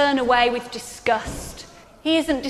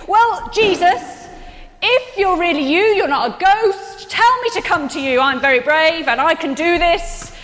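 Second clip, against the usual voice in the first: Peter, higher and louder, boldly daring; 5 semitones higher, 4 dB louder than usual. Retold or imitated. Peter boldly calls to Jesus across the water, higher and louder. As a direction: puff up like a brave fisherman calling out. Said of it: "his method of attacking was to say".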